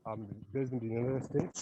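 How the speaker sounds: faint, far from the mic; barely audible, hard to hear; very low voice. Real, not acted. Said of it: "Your voice is very low".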